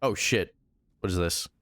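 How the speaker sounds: ordinary talk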